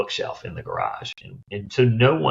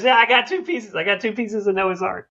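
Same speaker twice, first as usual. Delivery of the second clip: triumphant artist; 14 semitones higher than usual. Retold or imitated. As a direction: crow it like you've won the argument. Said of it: "he goes".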